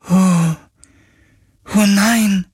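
high, broken cry of dismay; grieving spirit. Acted. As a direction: let the bad news crush the spirit. Spirit hears creators dead.

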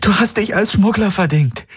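high, desperate urging. Acted. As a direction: press on in despair. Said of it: "fuhr Kirai verzweifelt fort".

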